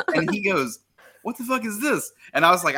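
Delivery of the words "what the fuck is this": high, puzzled "what the fuck"; high mock confusion at the door; confused outburst. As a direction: play the baffled roommate pitched up. Confused roommate voiced in story.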